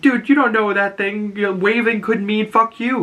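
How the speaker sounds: high, know-it-all imitated voice; squeaky smart-aleck caution; mock warning. Economos warns about alien.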